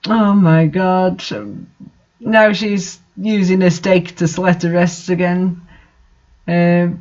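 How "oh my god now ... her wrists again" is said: usual speaking voice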